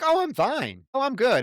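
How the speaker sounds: high, bright-eyed mock cheer; a sing-song show of being fine after a massacre; chipper girl. Mocking the final girl's cheerfulness, high and chipper. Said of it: "chipper as a fucking chipmunk".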